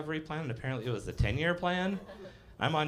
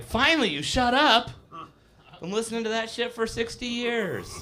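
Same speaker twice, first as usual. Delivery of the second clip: high, loud, fed-up retort; grandma to her dead husband, high and loud, fed up; mock grouchy old wife; 9 semitones higher, 6 dB louder than usual. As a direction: bark it like a relieved cranky grandma. Grandma relieved husband died.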